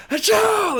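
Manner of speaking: sudden high shouted achoo; loud sneeze. Acted. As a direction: belt out a big fake sneeze. GM voices Olaf sneezing.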